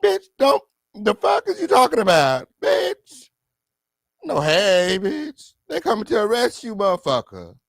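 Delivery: squeaky high mock bystander voice. Imitating a bystander yelling at Keefe D, high pitched. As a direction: play a shrieking onlooker. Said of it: "he just walk on over".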